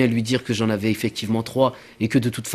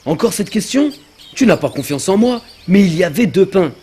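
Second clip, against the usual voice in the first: high-pitched offended protest; pitched-up outrage, a bit louder; indignant protest; 8 semitones higher, 4 dB louder than usual. Acted. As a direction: sound wronged, voice jumping up. Accused about the bread.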